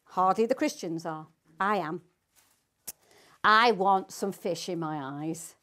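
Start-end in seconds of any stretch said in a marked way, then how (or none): none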